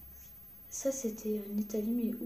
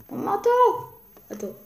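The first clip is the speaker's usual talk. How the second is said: much higher, louder burst; excited; 10 semitones higher, 7 dB louder than usual. Real, not acted. Girl delighted by her thumb on the tower. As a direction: squeal it as it hits you.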